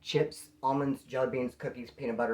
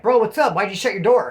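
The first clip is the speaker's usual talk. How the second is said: loud, higher challenge at the cell door; confrontational call-out; 4 semitones higher, 8 dB louder than usual. Retold or imitated. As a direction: call him out, ready to fight. Confronting the guy who disrespected him.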